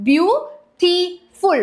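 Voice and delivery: ബ്യൂട്ടിഫുൾ broken into three slow, separate syllables; split into syllables. Shown as a demonstration. Teacher counting syllables for the class, ബ്യൂട്ടിഫുൾ split in three. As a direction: say ബ്യൂട്ടിഫുൾ slowly, pausing after each syllable. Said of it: "മൂന്ന് syllables ഇവിടെ വരുന്നുണ്ട്".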